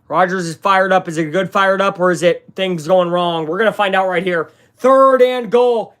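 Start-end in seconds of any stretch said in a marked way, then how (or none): 4.8-5.9 drawn-out, loud and high play call; excited call. Real, not acted. Commentator to viewers on the key play, loud, high and slow. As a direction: belt it out as the big down arrives. Tense final play on the stream.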